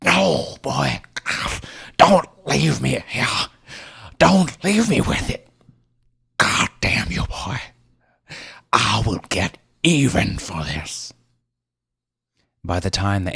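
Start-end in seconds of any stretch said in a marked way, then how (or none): 0.0-11.1 put-on dying man screaming curses at the boy; shrieked. A narrator's reading. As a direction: shriek after the fleeing boy. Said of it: "he shrieked at me".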